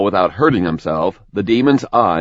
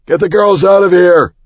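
urgent, loud and much higher; shout; 10 semitones higher, 7 dB louder than usual. Acted. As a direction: yell the order across the alley. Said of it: "he shouted at her".

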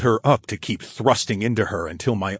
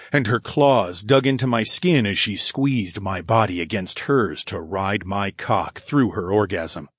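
ordinary delivery

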